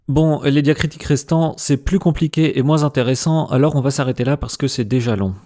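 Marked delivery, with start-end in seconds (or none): none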